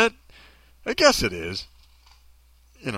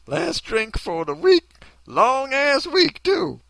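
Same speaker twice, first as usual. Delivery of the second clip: high, drawn-out mock character read; slow, pitched-up caption reading; imagined tweeter voice; 8 semitones higher, slower than usual. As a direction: read it slowly in a put-on high voice. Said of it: "sounds like that in my head when I read her tweets".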